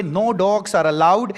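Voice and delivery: words stretched out very slowly; slow reading of a sign. Shown as a demonstration. Teacher reading a no-dogs sign slowly to students. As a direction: read the sign slowly and clearly. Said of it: "यहाँ पे".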